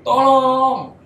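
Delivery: high, loud drawn-out cry for help; long, wailing yell; screaming for help. Retold or imitated. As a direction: re-enact a desperate scream. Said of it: "Tiba-tiba mulut teriak".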